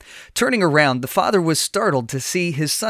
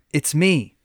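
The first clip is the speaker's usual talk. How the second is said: ordinary delivery, nothing marked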